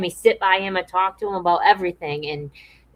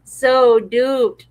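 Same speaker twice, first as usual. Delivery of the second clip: laughing; 5 semitones higher, 5 dB louder than usual. Real, not acted. Laughing repeat of own remark.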